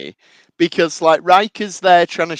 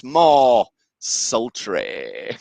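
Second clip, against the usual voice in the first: slow, drawn-out impression of a request; imitated order, savoured slowly; drawn-out line; 6 semitones lower, much slower than usual. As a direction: stretch both words out slowly as you imitate him. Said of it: "then he goes".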